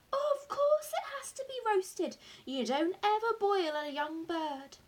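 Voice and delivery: high, astonished insistence; lively, incredulous correction; emphatic exclamation. A narrator's reading. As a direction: insist as if it's obvious. Father scoffs at son's question.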